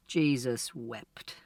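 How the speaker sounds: slow, soft, defeated sigh of words; exhausted father muttering in despair; weary despair. Acted. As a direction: say it like someone giving up with his head in his hands. Father head in hands.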